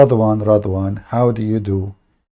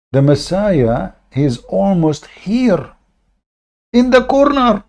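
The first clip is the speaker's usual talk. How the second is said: slow, rising to a much higher squeal on corner; mocking slow prophecy; 6 semitones higher, slower than usual. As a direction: tease the prophecy, stretching each word. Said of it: "mocking the prophecy".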